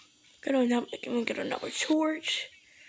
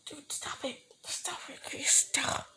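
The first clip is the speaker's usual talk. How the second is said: whisper, pleading with the monster; hushed begging, barely voiced; terrified whisper; frightened whisper; 5 dB quieter than usual. Real, not acted. Begging while hiding in fear.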